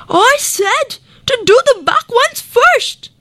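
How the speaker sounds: high, insistent call to the dentist; crocodile calling out. A narrator's reading. Crocodile calls out to the far-off dentist, insistent. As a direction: call out the order, impatient. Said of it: "the crocodile called out".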